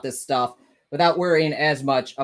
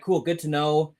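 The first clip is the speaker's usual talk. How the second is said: ordinary talk, nothing marked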